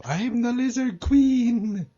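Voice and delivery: high, slow, drawn-out mock proclamation; delirious proclamation. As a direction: declare it grandly, as if hallucinating. Quoting a drugged Lisa Simpson.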